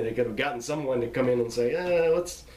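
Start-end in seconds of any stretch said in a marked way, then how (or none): 1.8-2.4 tag. Acted. Imagined director asking for retake.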